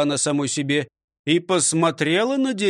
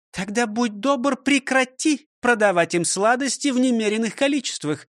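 higher, scolding schoolmistress voice; stern old teacher; 7 semitones higher than usual. Acted. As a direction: lecture him like a strict teacher. Teacher demands. (строгая учительница)

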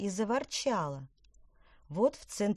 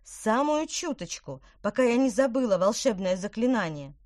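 pleading, raised and strained; irritated; 5 semitones higher, 7 dB louder than usual. Acted. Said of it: "раздражённо".

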